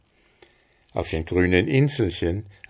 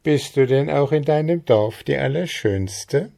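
höher, spöttisch fragend; neckende Frage; 7 semitones higher, 4 dB louder than usual. Acted. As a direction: schelmisch aufziehen. Trude teases Maren. (Trude zieht Maren mit höherer Stimme neckend auf)

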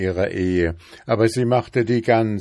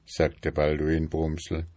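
plain reading voice

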